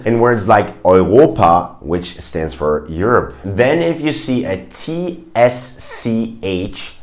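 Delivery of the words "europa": EUROPA as German "oy-RO-pa". Shown as a demonstration. Teacher gives an example word, EU as "oi". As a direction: begin EUROPA with an oi sound. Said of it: "it's pronounced Oi in German".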